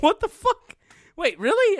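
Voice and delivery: very high, loud disbelief; astonished. Retold or imitated. Car owner to dad about the repair, astonished. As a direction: squeal it in laughing disbelief. Retold shock at being told.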